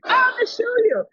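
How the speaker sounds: very high, drawn-out retort; imitated squealed comeback; mock insistence. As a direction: insist in a slow, high put-on voice. Acted argument over the start time.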